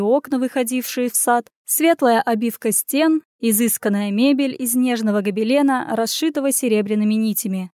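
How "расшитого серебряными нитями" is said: plain reading voice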